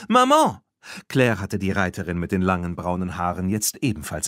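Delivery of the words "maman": high, loud joyful call to her mother; excited call. Acted. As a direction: call out to mom, thrilled. Claire spots her mother, calls out.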